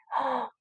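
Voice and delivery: short, high gasp of discovery; mock surprised gasp. Teacher spots something for the class, mock surprised gasp. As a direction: gasp as if you just spotted something.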